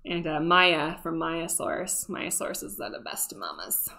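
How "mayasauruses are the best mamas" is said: a soft, breathy confiding whisper. Real, not acted. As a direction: whisper it as a tender little secret. Aside about dinosaur mothers.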